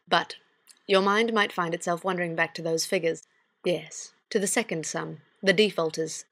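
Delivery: ordinary talk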